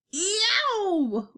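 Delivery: long high howl of "yow"; pained giant's howl, arching up and falling; giant's roar. A narrator's reading. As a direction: roar out a drawn-out, high-pitched yowl. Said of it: "roared the flesh lump eater".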